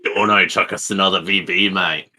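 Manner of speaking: put-on broad Queenslander Australian; Queensland accent. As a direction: call to a mate in hammed-up Queensland Aussie. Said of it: "That's a very Queenslander Australian accent".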